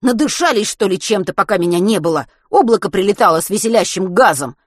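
loud, sarcastic outburst; exasperated sarcasm. A narrator's reading. Boy mocking adults who have all gone mad, exasperated.

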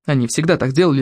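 ordinary delivery, nothing marked

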